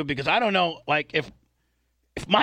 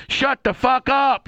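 louder, slower mock shout; mock yell; 8 semitones higher, 5 dB louder, slower than usual. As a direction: bark it as a gag punchline. Mock sponsor read then yell.

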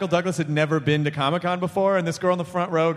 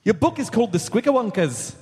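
a Scottish try that comes out Irish; Irish accent. Pretend. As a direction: put on an Irish brogue. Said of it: "Nope, that's Irish".

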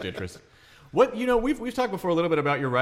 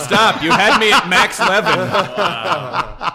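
high, slow, loud laughing line; 7 semitones higher, 11 dB louder, much slower than usual. Real, not acted. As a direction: say it through laughter. Laughing after pamphlet reading.

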